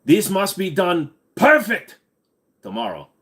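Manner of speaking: a pompous TV presenter laying down the law; imitating a blustering British car-show host; Jeremy Clarkson impression. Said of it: "Jeremy Clarkson".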